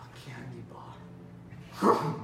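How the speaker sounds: slow, drawn-out whisper; hushed whisper. Acted. Cop searching for a suspect finds something, slow whisper. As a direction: whisper it slowly, as a discovery.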